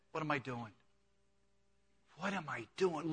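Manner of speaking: soft, dazed realization of the son; hushed self-questioning. Acted. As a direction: murmur it to yourself in dismay. Prodigal son's realization, hushed.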